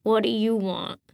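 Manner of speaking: put-on rough, suspicious old man; gruff. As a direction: bark it out warily. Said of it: "a gruff voice asked".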